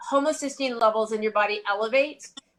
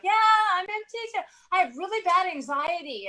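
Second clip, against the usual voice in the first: imitating a patient; 8 semitones higher, faster than usual. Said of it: "they're like".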